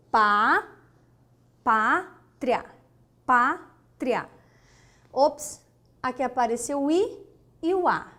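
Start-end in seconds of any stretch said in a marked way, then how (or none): 0.1-4.3 pá then pátria, stressing pá; syllable split. Shown as a demonstration. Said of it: "não ficaria assim". (separação de pátria)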